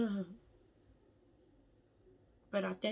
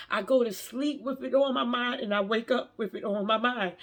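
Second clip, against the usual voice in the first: louder, animated telling; excited; 3 semitones higher, 7 dB louder than usual. Real, not acted. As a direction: burst out with real excitement. Excited telling about the song.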